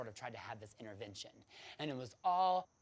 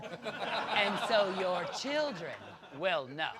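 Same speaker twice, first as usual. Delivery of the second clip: laughing; 7 semitones higher, 9 dB louder, much slower than usual. Retold or imitated. Telling the pastor off.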